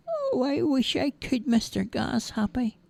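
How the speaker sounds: put-on high childish voice, soft and longing; little boy wishing; wistful boy. Roger wishes he could fly, high and soft.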